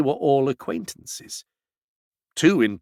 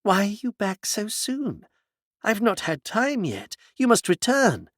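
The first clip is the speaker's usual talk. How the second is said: gentle reproach; 8 semitones higher than usual. A narrator's reading. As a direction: chide him gently, like a disappointed friend. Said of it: "Tidomin addressed him in tones of gentle reproach".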